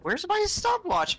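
high panicked wail. Real, not acted. Ghost event hits the player.

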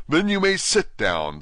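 high, slow loud dismissal; a pompous royal joke; king dismissing the kneeling Hatter. Acted.

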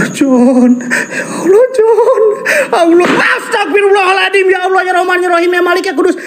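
very high, terrified cries to God; real panicked wailing. Real, not acted. Terrified ghost hunter calls John and God, panicked high wail. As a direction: wail out prayers in sheer panic, pitch far up. Panicked prayers after a fright.

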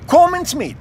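high, loud call to follow; urgent call to come along. Acted. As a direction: shout them along after you. Urging others to follow quickly.

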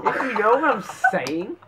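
mocking echo. Mocking echo of the British attempt.

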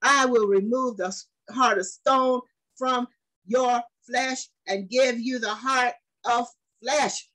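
slow, weighty, drawn-out reading; preaching scripture. Acted. Preacher reading the covenant to his congregation, slow and weighty. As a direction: lay each word down slowly.

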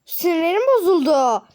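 loud, slow wail of frustration; nerves frayed. Pretend. Vlogger upset over jumbled suitcases, frustrated drawn-out wail. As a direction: draw it out high and loud, thoroughly fed up. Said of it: "sinirlerim çok fazla bozuldu".

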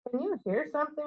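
a nervous whisper. A narrator's reading. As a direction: hush it nervously in the dark. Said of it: "Ron whispered".

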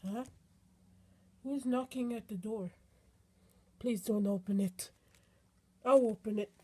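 ordinary talk

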